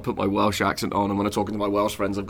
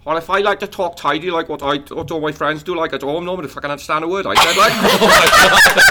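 put-on higher-pitched, throaty Newport accent; 5 semitones higher, 5 dB louder than usual. Showing how his friends in Newport talk, throaty Newport accent. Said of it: "the Newport accent would be like".

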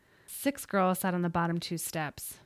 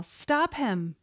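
yelling; 6 semitones higher than usual. A narrator's reading. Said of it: "She heard Katrina yell".

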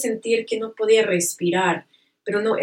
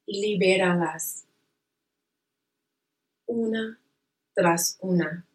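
slow, soothing meditation guide; calm guided relaxation voice. Acted.

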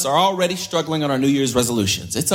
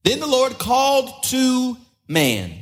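slow, raised reading voice; slow dramatic scripture reading; 7 semitones higher, slower than usual. Acted. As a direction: read the Bible passage with drama. Reading God calling in Genesis.

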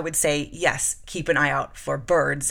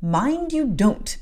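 drawn-out stern warning; slow warning; 7 semitones higher, 3 dB louder, much slower than usual. Acted. Bessie to Jane, slow stern warning. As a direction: warn her slowly. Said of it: "said Bessie".

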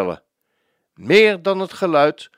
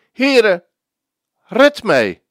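high, loud plea for rescue; sinking man's loud cry; desperate cry; 6 semitones higher, 6 dB louder, much slower than usual. Acted. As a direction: shout for help while sinking. Sermon repeats Peter's cry.

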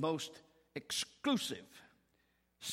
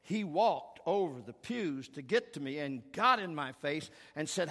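ordinary talk, nothing marked

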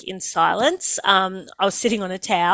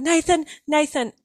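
urgent calling; 7 semitones higher than usual. Retold or imitated. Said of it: "And I remember going".

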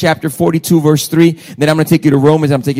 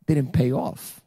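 normal talking voice

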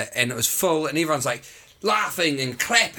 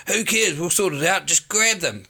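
much higher, brighter blokey mate pitching a scheme; imitating Josh; 6 semitones higher than usual. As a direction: do your mate hyping up free kegs, high and chatty. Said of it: "He's like".

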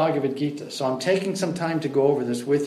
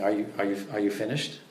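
softer, patient, unhurried question; 7 semitones lower, 8 dB quieter, faster than usual. Retold or imitated. Prabhupada patiently asks the arguing student, quiet and calm. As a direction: wait him out gently before asking. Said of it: "sat there very patiently".

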